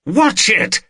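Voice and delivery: high sudden cry; husband yelling about the parked car; sharp warning. Acted. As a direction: snap out a warning.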